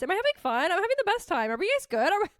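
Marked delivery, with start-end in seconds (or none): 2.1-2.3 high, fast hype-up to the audience; hyped emcee voice checking the crowd; hyped crowd call. Real, not acted. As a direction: pump up the crowd like a host. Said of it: "is this thing on".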